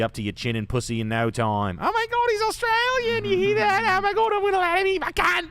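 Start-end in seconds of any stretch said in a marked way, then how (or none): 1.8-5.5 much higher, louder gushing falsetto of an American woman swooning over an Aussie. Retold or imitated. Mocking American women drooling over an Australian nurse, high gushing falsetto. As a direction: squeal it like a starstruck American girl, Australian accent and all.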